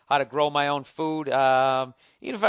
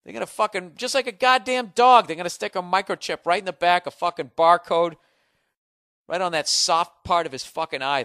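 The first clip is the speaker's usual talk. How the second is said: loud, higher ranting escalation; ranting rage; 4 semitones higher, 6 dB louder than usual. Real, not acted. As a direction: rant it, building fury. Ranting about microchips, building fury.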